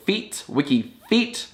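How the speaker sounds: "feet, Wiki feet" slow, "feet" picked out; emphasized final syllable. Shown as a demonstration. Showing viewers why the lyric works, emphasized final syllable. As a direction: stretch "feet" slowly, alone and then after "Wiki". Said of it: "'cause the final syllable".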